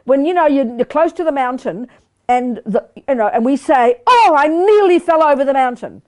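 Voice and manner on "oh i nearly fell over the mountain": higher, louder mock alarm; alarmed exclamation. Teacher acting out a near fall off a mountain, mock alarm. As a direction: cry out as if you just had a near miss.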